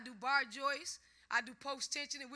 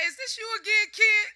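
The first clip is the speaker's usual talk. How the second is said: loud, high imitation of a fed-up man; exasperated mock yell; 9 semitones higher, 16 dB louder than usual. Man answering her repeated calls, exasperated mock yell. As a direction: bark it like he's sick of the calls.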